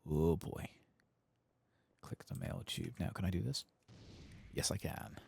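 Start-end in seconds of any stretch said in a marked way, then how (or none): none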